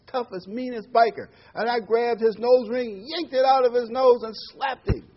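hammed-up tough-guy bragging, building to a peak; boastful cowboy build-up. Cowboy bragging to Saint Peter about bikers, mounting excitement.

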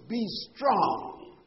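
STRONG pushed out loud and high; emphatic preacher's punch on "strong"; stress on STRONG. Real, not acted. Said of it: "I wanna emphasize certain words".

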